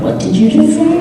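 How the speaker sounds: high, offended disbelief; mock indignant. Actor retells a fan's remark, mock outrage. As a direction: act insulted, playfully. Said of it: "And I was like".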